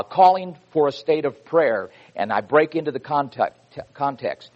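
ordinary talk, nothing marked

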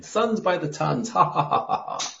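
high, taunting debater's cackle; jeering sing-song with laughter; mocking laugh. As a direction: mimic a gloating opponent laughing. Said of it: "and laughing".

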